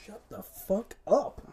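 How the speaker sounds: loud, high, slow snap; yell at the cat. Real, not acted. Host yelling at the noisy cat, loud. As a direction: bark it at the cat.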